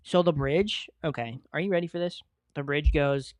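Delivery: ordinary delivery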